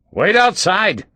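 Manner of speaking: loud barked order; roaring. A narrator's reading. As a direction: roar it out, scared. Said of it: "his voice sounded like a roar".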